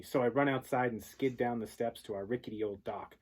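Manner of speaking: usual speaking voice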